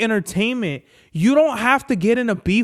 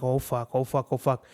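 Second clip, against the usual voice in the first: low, quiet, worried repeating to oneself; retold dread; 5 semitones lower, 8 dB quieter than usual. Owner dreading his dog's reaction, retold low dread. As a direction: recall dreading it under your breath. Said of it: "I'm scared of my dog's reaction".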